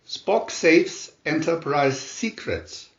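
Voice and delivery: ordinary delivery, nothing marked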